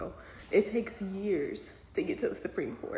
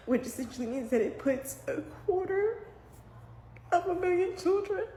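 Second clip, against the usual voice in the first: fighting back tears; 10 semitones higher than usual. Real, not acted. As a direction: pitch rising into a tearful squeak. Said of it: "I'm trying not to cry".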